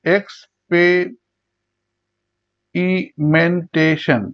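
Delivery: Experimentation very slowly in six beats; long pauses between syllables of experimentation. Shown as a demonstration. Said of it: "ছয়বার উচ্চারণ করা হয়".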